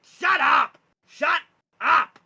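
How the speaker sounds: high, full-force goblin shout; screeched, furious goblin order; goblin yelling. Acted. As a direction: scream at the beast while pelting it. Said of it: "starts tossing them at the dragon's head and".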